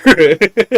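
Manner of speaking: loud high laugh breaking out; burst of laughter. Real, not acted. Co-host laughs loudly at his own ice wall joke, loud high laughter.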